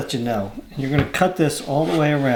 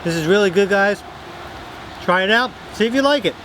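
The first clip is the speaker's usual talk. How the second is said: excited sign-off; 6 semitones higher than usual. Real, not acted. Upbeat sign-off to viewers.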